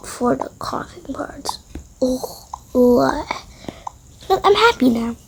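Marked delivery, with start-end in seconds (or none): none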